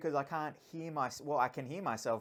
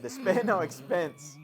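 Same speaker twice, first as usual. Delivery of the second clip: slow, louder, deliberate quote; quoting the actor; 6 semitones higher, 8 dB louder, slower than usual. Retold or imitated. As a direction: say it like a grand movie line. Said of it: "and he said".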